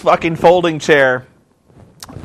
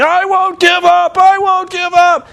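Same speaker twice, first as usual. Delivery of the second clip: high, drawn-out imitation of a wrestler's scream; hammed-up agonised defiance, voice way up; 13 semitones higher, 4 dB louder, slower than usual. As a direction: scream it long and high, mimicking pain. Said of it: "He's screaming".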